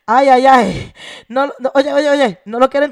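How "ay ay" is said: normal talking voice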